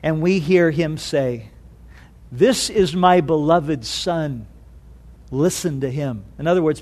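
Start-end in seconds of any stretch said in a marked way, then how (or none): 2.3-6.2 low, slow, solemn voice from heaven; measured, lowered voice of God; Heavenly Father speaking. Acted. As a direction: pronounce it slowly and quietly, with divine authority. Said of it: "his voice is sounded from heaven".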